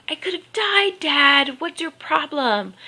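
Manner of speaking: high-pitched mock yell at dad; retold yelling. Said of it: "I end up yelling at him going".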